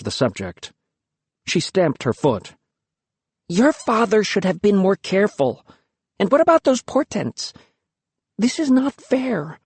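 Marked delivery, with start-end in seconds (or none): 3.5-9.6 high, slowing indignant protest; indignant girl. Acted. Luki outraged on her brother's behalf, high indignation. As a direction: stamp out the unfairness.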